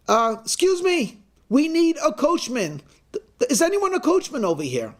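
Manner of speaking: high-pitched announcement to a room; calling out. Acted. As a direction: call out for volunteers, pitched well up. Secretary calls out to waiting room.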